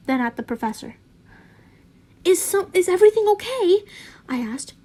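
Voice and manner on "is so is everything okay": high, loud and fast worried question; alarmed. Acted. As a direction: blurt it out in alarm. Scorpius alarmed.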